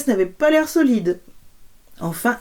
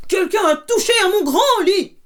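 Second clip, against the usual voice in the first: big bear shouting; 12 semitones higher, 4 dB louder than usual. Acted. Big bear discovers the bed.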